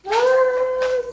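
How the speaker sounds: high, loud "ah" of excitement; long high-pitched thrilled squeal; excited squeal. Real, not acted. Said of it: "so exciting".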